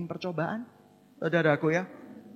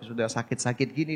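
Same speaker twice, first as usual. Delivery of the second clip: usual speaking voice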